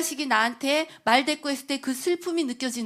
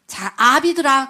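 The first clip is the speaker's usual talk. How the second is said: solemn call; 3 semitones higher, 10 dB louder, slower than usual. Acted. Preacher reading Scripture's call to fathers, loud and slow. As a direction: summon the fathers from the pulpit.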